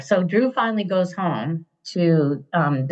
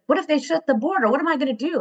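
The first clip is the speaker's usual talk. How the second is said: high, hurried mock panic; imitated worried son; 9 semitones higher, faster than usual. Son calling mom from Vietnam about borders, imitated worry. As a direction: mimic a fretting son, pitched up and quick.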